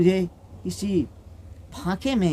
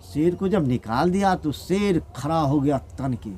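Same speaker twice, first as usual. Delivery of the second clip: ordinary delivery, nothing marked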